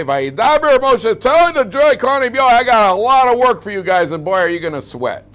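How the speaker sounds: hammed-up harsh order to the Kohanim; harsh demanding boss. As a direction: lay on the burden like a shouting foreman.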